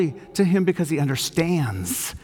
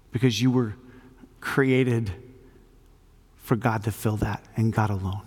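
prayer; 4 semitones lower than usual. Real, not acted. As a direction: pray gently and unhurriedly, leaving space between phrases.

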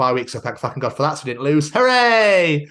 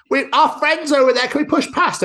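lightly voiced women, higher; mocking pushy women; 4 dB louder than usual. Mocking women pushing past at the gig, higher. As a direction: mimic the pushy women, a touch high. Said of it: "some women kept being like".

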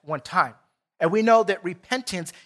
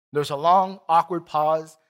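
ordinary delivery

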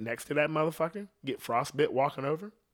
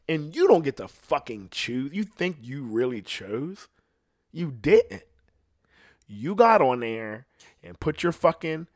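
ordinary delivery

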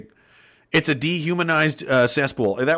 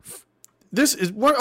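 high, baffled questions; puzzled disbelief at the screen; high confused what; 10 semitones higher than usual. Real, not acted. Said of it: "Okay, I'm really confused".

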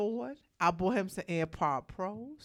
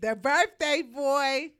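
higher, louder, slow hype shout; hyped echo; 6 semitones higher, 6 dB louder, slower than usual. Real, not acted. Host hyping the birthday DJ on air, loud and slow. Echoes cohost's intro, hyped.

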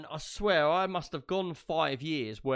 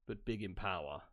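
mock grand low voice; 6 semitones lower than usual. Boasting about small country.

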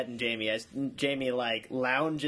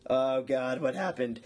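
normal talking voice

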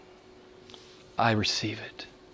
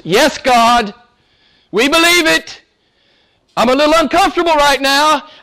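much higher, louder, earnest praying voice; voiced believer's prayer; 13 semitones higher, 4 dB louder than usual. Believer praying aloud to God, earnest raised voice. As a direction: cry out to God as a struggling believer.

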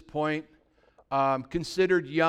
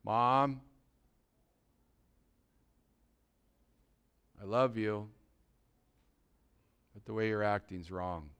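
low and soft, with long careful pauses; hushed, loving reproof; gentle, loving correction; 8 semitones lower, 9 dB quieter than usual. Acted. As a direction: break it to mom tenderly. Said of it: "If you come in with that gentle attitude and a loving attitude".

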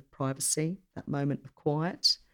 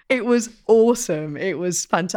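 loud and high, delighted; excited; 4 semitones higher, 7 dB louder than usual. Real, not acted. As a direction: burst out with delight. Enthusing about a wedding.

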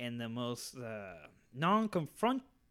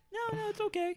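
much higher put-on kid voice; high mock kid voice. The kid refusing to leave, in a high mock voice. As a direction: squeak out the kid's casual refusal. Imitating the kid's reply.

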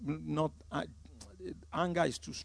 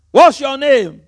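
loud, higher mock demand to the demon; mock interrogation; 8 semitones higher, 17 dB louder, slower than usual. A minister interrogating an evil spirit, a loud mocking demand. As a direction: bellow it like a showy exorcist.

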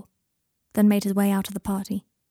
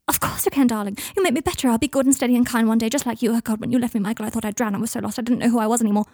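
desperate pleading; 3 semitones higher, 4 dB louder, faster than usual. Acted. Draco begging Michael to stay, desperate. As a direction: plead in a rising rush, easing into confession. Desperate plea to leaving boyfriend.

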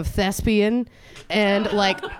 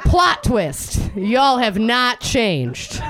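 loud, drawn-out punchline; big, slow comic declaration; emphatic verdict; 4 semitones higher, 4 dB louder, much slower than usual. Pretend. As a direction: announce it slowly to the whole room. Loud answer to own question.

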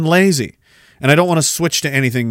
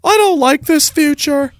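higher, whiny put-on voice; mock scared; 9 semitones higher than usual. Old man facing a smart speaker, whiny put-on voice. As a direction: whimper it like a spooked old man.

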